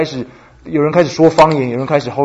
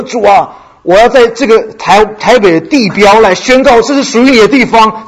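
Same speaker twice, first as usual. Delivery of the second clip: 抬高声音，大声向神宣告; 像在台北地标上呼喊宣告; 高声宣告; 7 semitones higher, 5 dB louder than usual. Retold or imitated. 在火车站向神高声宣告. (响亮高昂的宣告祷告)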